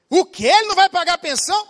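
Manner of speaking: high, loud, fast mock outrage of the wife; outraged wife. Wife protesting to the Japanese judge, loud mock outrage. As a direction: blurt it out, scandalized at the court.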